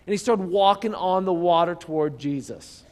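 normal talking voice

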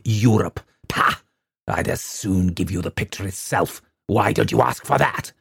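bitter, contemptuous tirade; snarling scorn. A narrator's reading. Father sneers at the young man's request, snarling contempt. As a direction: spit out the scorn for the vandals. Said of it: "interrupted him with a snarl".

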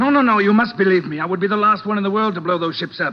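high, loud, frantic pleading; panicked denial. Acted. Surratt to the detective, high and panicked. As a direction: beg to be believed, panicking. Suspect panicking under accusation.

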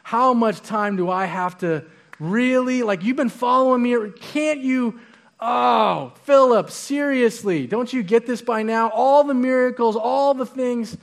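playfully exasperated teacher pleading with a slow student; exasperated Jesus. Retold or imitated. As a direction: come on, seriously, don't you get it. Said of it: "Jesus is like".

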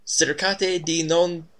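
slow, hammed-up Italian accent. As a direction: drawl it like an operatic Italian. Narrator voices Italian guard.

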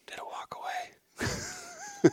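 breathy imitated whisper, slow and high; retold whisper. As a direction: mimic her hushed question from the blind. Wife whispering near elk in hunt.

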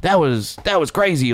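amazed backstage. Retold or imitated. Lil Rel backstage praising the bit, excited. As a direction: gush about the set. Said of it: "and goes".

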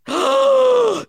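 sharp high loud gasp of shock. Real, not acted. Seeing the centipede photo, a shocked gasp. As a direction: gasp at the picture.

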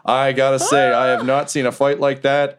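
big, lilting announcer delivery; [name]. Acted. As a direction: address the crowd as the fight's emcee. [name] says, standing up.

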